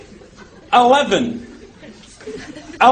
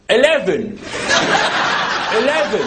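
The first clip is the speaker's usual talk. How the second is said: mock English accent; 5 semitones lower than usual. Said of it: "Try an English accent".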